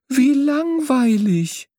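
high, long-drawn complaint; bored dismay. A narrator's reading. Young bird hears they always stay home, bored dismay. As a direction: stretch it out in high-pitched disappointment.

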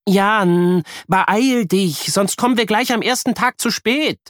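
a hint of a rushing mother calling. A narrator's reading. Mother calls her son to hurry before school. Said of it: "ruft sie ihm zu".

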